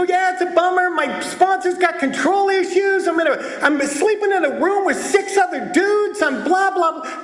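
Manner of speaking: high-pitched grumbling complaint; griping newcomer. Acted. Newcomer venting to the meeting about his sponsor, griping. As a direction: rattle off gripes in a high voice.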